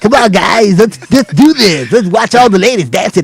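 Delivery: slow, hammed-up old radio DJ. Club DJ hyping the crowd, hammed-up radio voice. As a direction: drag it out like a strip club DJ. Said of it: "He's got that D J voice".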